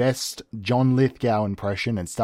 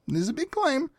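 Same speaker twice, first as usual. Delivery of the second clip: shocked. Real, not acted. Repeats the shocked reaction.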